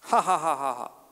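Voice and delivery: a flat mocking ha-ha; hammed-up scornful laughter; sarcastic fake laugh. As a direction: laugh on purpose without meaning it. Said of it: "Sehr witzig. Ich lach mich tot".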